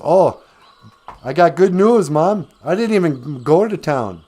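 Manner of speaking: eager, naive boasting to a parent; excited good news. Acted. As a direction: burst out with it, pleased with yourself. Jack proudly reports to his mom.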